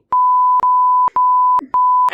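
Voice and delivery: high-pitched loud laughing fit; high laugh burst. Real, not acted. Cracking up mid-bit.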